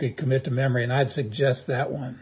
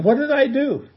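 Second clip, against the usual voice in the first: very high, squeaky mock dismay; an even higher put-on whine; indignant vegan; 11 semitones higher, 3 dB louder than usual. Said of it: "some vegan ... is also thinking".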